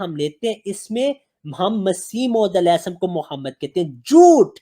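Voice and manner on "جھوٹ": loud, high, outraged shout; angry shout. Real, not acted. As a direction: bark the accusation out. Angry debate, calls claim a lie.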